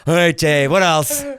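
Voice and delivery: loud, brisk push onward; impatient host. Retold or imitated. Imitating David moving Jay along, loud and brisk. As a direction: hurry the guest along. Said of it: "And he'd be like".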